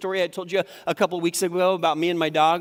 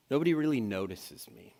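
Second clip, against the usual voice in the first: "Nobody" sinking, much softer; quietly defeated; 7 semitones lower, 7 dB quieter than usual. Shown as a demonstration. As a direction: let it fade to a sad hush. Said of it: "distortions sound more like this".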